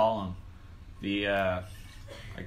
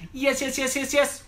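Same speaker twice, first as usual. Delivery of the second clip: high, rapid string of yeses; mock porn star; 14 semitones higher than usual. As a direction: imitate a porn star, pitched way up. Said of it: "porn stars are like".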